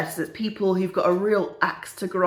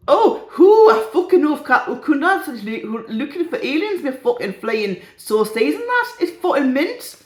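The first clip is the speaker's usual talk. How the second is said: loud, higher mock Geordie accent; 6 semitones higher, 5 dB louder than usual. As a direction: have a go at broad, sweary Geordie. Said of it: "had a go at Geordie accent there".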